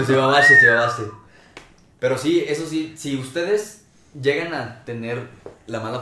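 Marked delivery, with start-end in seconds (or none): 0.0-1.0 felicitación fuerte. Real, not acted. Felicita a la amiga.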